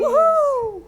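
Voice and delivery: high, loud whoop; cheering. Pretend. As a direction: whoop with joy. Cheering along with the story.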